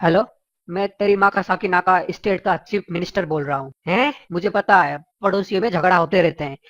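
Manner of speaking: pompous chief minister. Acted. Chief minister phones Billa about the neighbour, official and low. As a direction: speak like a minister lecturing a citizen.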